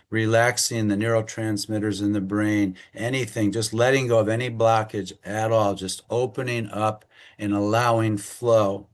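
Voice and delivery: usual speaking voice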